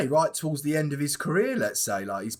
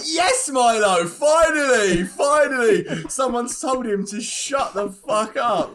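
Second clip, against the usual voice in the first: excited shout; 6 semitones higher, 4 dB louder, much slower than usual. Retold or imitated. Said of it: "are like".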